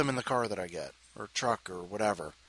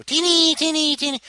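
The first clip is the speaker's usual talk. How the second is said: high squeaky voice for the kitty; cutesy cat voice; 6 dB louder than usual. Real, not acted. Calling the cat by name.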